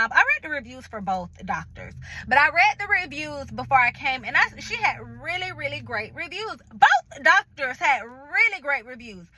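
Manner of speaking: normal talking voice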